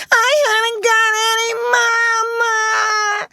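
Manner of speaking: high, loud, drawn-out bawl; shrieking, crying tot; wailing child. A narrator's reading. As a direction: howl it like a tantruming little girl. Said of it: "angry wails of some child".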